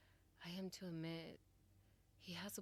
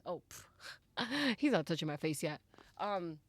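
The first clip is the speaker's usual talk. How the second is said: much louder, laughing through the words; flustered laughter; 12 dB louder than usual. Real, not acted. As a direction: laugh it off, overexcited.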